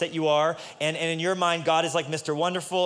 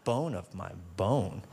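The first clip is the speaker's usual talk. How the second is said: much lower, very slow, mock-romantic croon; Adam's love poem; 8 semitones lower, 5 dB quieter, much slower than usual. Adam reciting his poem to Eve, lower, slow and mock-swooning. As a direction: ham up a smitten lover's verse. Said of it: "here's how the poem went".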